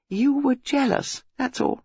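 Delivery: high, petulant female voice; taunting. Acted. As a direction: needle the boyfriend in a quarrel. Acted lovers' quarrel.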